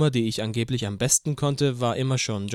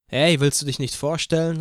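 louder, higher call to a newcomer; 4 semitones higher, 6 dB louder than usual. A narrator's reading. Guitarist to the newcomer at the campfire, calling out. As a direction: call over, not unfriendly. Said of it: "rief der Gitarrist".

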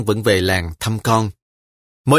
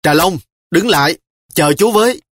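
raised, higher call after her; 6 semitones higher, 5 dB louder than usual. A narrator's reading. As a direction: shout after a running child. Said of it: "Tôi hốt hoảng chạy theo".

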